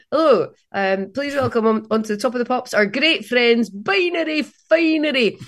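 hammed-up band introduction, rising high on the band name; TV presenter intro. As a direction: announce the band like a showbiz host. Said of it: "I went".

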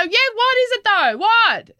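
put-on high insistent kid voice; imitating kids. Mother imitating her son pressing his sister, high pitched. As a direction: press for the answer like a nosy kid. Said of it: "They go".

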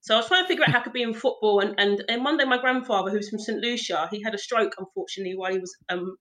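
normal talking voice

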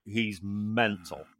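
quiet, slow deadpan aside; mild muttered verdict on the instructor. Retold or imitated. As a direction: drop it softly and slowly to yourself. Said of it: "I'm like".

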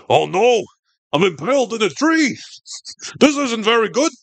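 a deliberately bad Coach Martinez accent, played for laughs. Podcast host voicing the impaled coach in a bad Coach Martinez accent. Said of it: "my famously inaccurate Coach Martinez accent".

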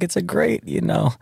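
high mock voice of his friend; imitating Tiga. Mocking friend Tiga telling [name] he's stiff, high. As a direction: pitch it way up, teasing. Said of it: "he's like".

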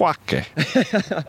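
a single high, nasal-free mock duck quack with Italian flair; playful imitation of a duck quacking in an Italian accent. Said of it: "what would an Italian quack sound like".

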